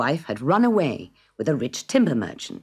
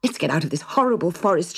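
crying out; 5 semitones higher, 5 dB louder than usual. Acted. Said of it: "she cried".

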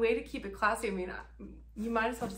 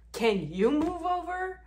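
loud, higher, mocking demand; mock yell; 9 semitones higher, 7 dB louder than usual. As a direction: throw her nagging back at her. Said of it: "yelling at him".

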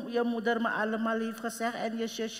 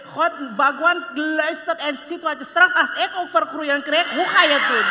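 heated outburst; 3 semitones higher, 7 dB louder than usual. Acted. Heated argument with husband.

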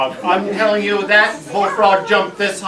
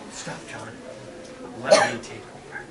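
much softer, breathy whisper; 6 semitones lower, 7 dB quieter than usual. Retold or imitated. Sister quietly taking over the hunt, whispered. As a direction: hush him and take charge. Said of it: "Gina said".

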